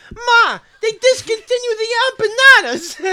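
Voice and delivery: high, shouted Italian-guy caricature, half laughing; classic Italian guy. Retold or imitated. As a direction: holler for Ma like an exaggerated Italian son. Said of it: "sounds like classic Italian guy".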